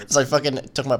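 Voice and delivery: ordinary talk, nothing marked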